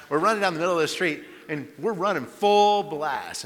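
excited retelling. Retold or imitated.

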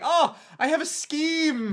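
put-on high, slow posh English accent. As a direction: drawl it like a posh English schemer. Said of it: "That was all right accent work".

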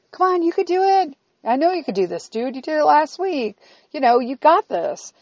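put-on bright upbeat pep talk to a kid; cheery encouraging mom. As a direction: cheer him on, high and sunny. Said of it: "I have to be like".

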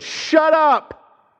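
Jesus voiced as a curt, high shout; blunt command. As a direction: bark it like a rebuke to a demon. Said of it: "You know what Jesus really said?".

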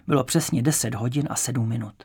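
ordinary delivery

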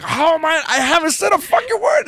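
high, shouty retold denial; mock outrage, pitch way up; indignant protest. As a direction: cry foul as the falsely accused. Retold protest at being yelled at.

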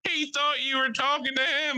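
high, slow jeering voice; hammed-up teasing bystander; imitated heckler. Imitating laughing bystander at bar.

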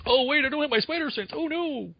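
high, hammed-up mock panic. Mocking Spider-Man without spider sense, hammed-up high panic. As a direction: squeak it like a fake-scared hero.

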